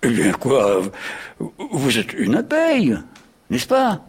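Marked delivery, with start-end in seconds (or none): 1.7-4.0 much higher, rising eagerness; eager question. A narrator's reading. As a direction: perk up with sudden interest. Wasp suddenly interested, hopeful.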